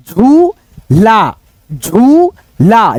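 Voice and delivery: झूला with the ऊ drawn out very long; stretched long vowel. Shown as a demonstration. Teacher dictating झूला with a long ऊ. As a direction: draw out झूला slowly on the ऊ. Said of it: "उच्चारण बड़ा हो रहा है खींच करके".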